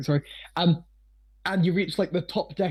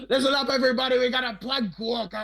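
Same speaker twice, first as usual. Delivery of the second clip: high pirate barmaid's call to the crowd; hollered pirate announcement without full volume; announcing to the room; 6 semitones higher, faster than usual. Acted. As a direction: call the whole tavern to attention, pitched up. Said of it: "she turns around to the whole room and goes".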